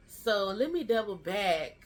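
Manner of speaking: usual speaking voice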